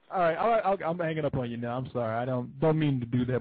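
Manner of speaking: usual speaking voice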